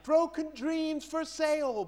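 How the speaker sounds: high, slow hawker's call; market seller cry. Pretend. Preacher playing a market seller selling broken dreams, calling out. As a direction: cry it out like a street trader. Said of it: "a market seller standing there selling".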